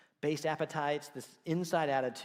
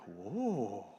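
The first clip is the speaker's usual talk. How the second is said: low, drawn-out whoa; be quietly bowled over; impressed whoa; 6 semitones lower, 3 dB quieter than usual. Retold or imitated. Reading about his future wife online, a low impressed whoa. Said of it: "I was like".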